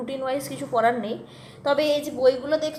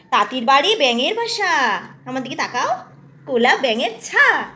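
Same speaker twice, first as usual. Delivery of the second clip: put-on reading to a toddler; 5 dB louder than usual. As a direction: recite it brightly for a small child. Mother reciting rhyme to toddler.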